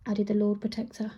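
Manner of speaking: ordinary delivery, nothing marked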